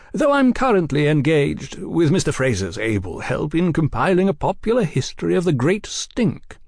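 lightly voiced, genial gentleman; cordial gentleman journalist. A narrator's reading. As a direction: explain yourself with airy cordiality. Oliphant explains himself genially.

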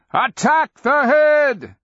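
loud, high, drawn-out battle command; Akamian's scream. A narrator's reading. Wizard urges his allies against the dragon, screaming. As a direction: scream the order slowly at full force. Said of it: "Akamian screamed".

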